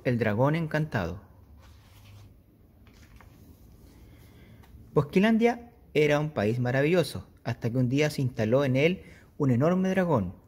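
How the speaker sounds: put-on lively read-aloud for kids; storytelling voice. A narrator's reading. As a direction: tell it like a bedtime story. Teacher reads story aloud.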